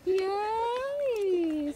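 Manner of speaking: high squeaky cheer; squeaky praising a dog. Real, not acted.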